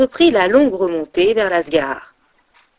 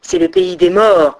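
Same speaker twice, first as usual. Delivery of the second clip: forbidding voice; 8 semitones higher, 4 dB louder than usual. A narrator's reading. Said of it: "reprit la voix avec le vent".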